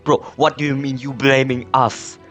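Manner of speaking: usual speaking voice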